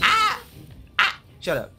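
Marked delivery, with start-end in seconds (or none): 0.0-0.4 sharp, very high, loud yelp; high pained scream. Real, not acted. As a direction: shriek as the hit lands. Hit by an enemy.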